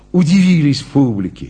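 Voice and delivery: usual speaking voice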